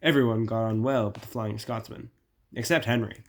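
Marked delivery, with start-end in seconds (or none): none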